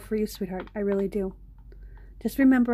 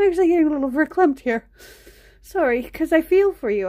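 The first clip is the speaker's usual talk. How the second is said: a touch choked up, faster and higher; getting teary; 6 semitones higher, 4 dB louder than usual. Real, not acted. To a viewer having panic attacks, getting choked up. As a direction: emotion rising in the voice as she talks. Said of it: "I'm actually getting kinda teary".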